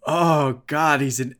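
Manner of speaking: groaning, hammed-up dismay; mock disgust. Retelling characters finding out he's just an actor, mock disgust.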